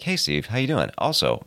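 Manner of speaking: normal talking voice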